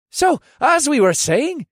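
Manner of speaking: high, loud, cheerful and drawn out. A narrator's reading. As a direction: pick the chat back up cheerily. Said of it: "in a cheerful voice".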